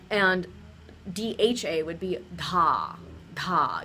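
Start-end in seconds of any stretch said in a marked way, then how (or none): 2.4-3.8 dha with a little puff of air; aspirated dha. Shown as a demonstration. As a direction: let air burst out after the D in dha. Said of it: "that little puff of air".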